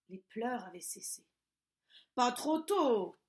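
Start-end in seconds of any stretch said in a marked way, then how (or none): 2.1-3.2 put-on slow, louder grumble of an old witch; grumbling. A narrator's reading. Old witch grumbling as the crying stops. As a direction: grumble it out, fed up. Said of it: "grogna la sorcière".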